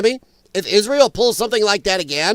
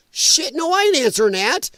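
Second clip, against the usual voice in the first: higher-pitched, emphatic put-on refusal; mock refusal; 7 semitones higher than usual. Underling refusing to answer Netanyahu's phone, mock alarmed refusal. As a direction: play someone refusing point-blank.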